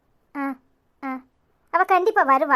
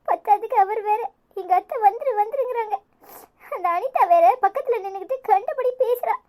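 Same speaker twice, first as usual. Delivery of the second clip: crying; 4 semitones higher than usual. Acted. Niece explaining to friend why she hung up, crying. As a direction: explain while fighting back tears. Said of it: "ஏண்டி இப்படி அழுற?".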